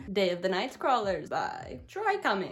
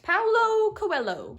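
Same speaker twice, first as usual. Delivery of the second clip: drawn out; 10 semitones higher, 7 dB louder, much slower than usual. Shown as a demonstration. Drawn out name.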